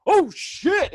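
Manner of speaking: high, loud retold jump-scare yelp; startled yell. Telling friends how the jump scare got him, startled yell.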